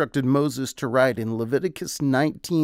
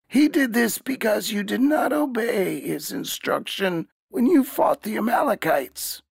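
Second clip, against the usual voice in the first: higher, shouted condemnation; shouting down the disobedient king; angry rebuke; 9 semitones higher than usual. Acted. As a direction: thunder at him like a wrathful prophet. Samuel's spirit rebukes the king.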